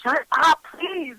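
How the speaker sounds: retold fed-up plea, falling off at the end; exasperated plea. Woman begging the chatter to stop, exasperated.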